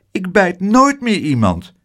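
high, insistent little voice; high-pitched young character, pleading; earnest child. A narrator's reading. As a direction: promise it like an eager child. Child pleads he no longer bites.

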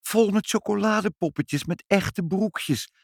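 put-on high, delighted little-girl voice. A narrator's reading. Child unwrapping a present from her parents, delighted. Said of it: "zei Ibbeltje verrukt".

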